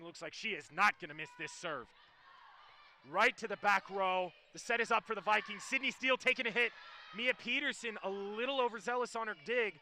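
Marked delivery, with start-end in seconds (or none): 3.1-9.7 running call climbing to a high, loud peak; live play-by-play. Real, not acted. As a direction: follow the rally up to the point.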